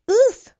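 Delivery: loud, high winded oof; knocked breathless by the crash; impact grunt. A narrator's reading. Heroes crash in midair.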